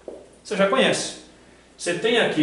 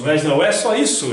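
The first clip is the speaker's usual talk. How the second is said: infomercial; 6 dB louder than usual. Pretend. Anúncio de TV paródia.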